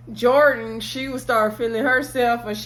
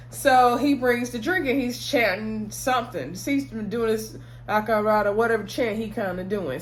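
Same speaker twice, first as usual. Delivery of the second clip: ordinary delivery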